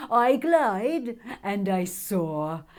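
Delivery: slow, loud, lofty dragon boast; proud dragon. Acted. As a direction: soar on every word. Sandra dragon voice.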